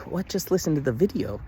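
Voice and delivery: mock protest, lightly exasperated; annoyed retort. Son retelling his reaction to mom's worried voicemail, exasperated. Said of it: "annoyed 'cause".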